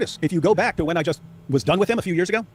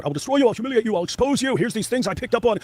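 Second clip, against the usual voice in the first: much higher mocking threat voice; Sam in attack mode; 8 semitones higher than usual. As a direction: parody the bully on the attack. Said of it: "he goes into attack mode".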